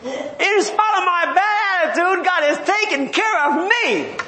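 bubbling over with self-satisfied joy; delighted Jonah. Retold or imitated. Preacher acting Jonah thrilled by the shade, gleeful. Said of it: "Jonah's thinking".

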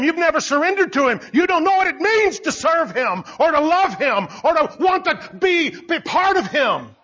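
high, driving sermon delivery; preacher rebuking unbelievers, high and driving; preaching at full force. Acted. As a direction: hammer each question home.

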